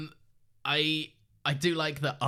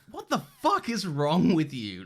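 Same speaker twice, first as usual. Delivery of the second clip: appalled disbelief, raised and drawn out; slow, loud, higher voicing of an aghast look; Kendall; 5 semitones higher, 5 dB louder, slower than usual. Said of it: "Kendall's look of, like".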